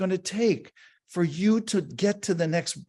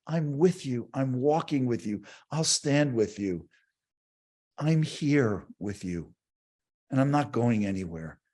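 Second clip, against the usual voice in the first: gentle reassurance; 5 semitones lower than usual. Retold or imitated. Healing presence speaking comfort.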